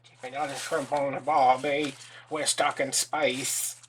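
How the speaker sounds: loud, drawn-out fake Australian; bad Australian accent. Crafter who can't do Australian trying anyway, bad Australian accent. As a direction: attempt Australian badly, dragging out the ending. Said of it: "I can't do Australian".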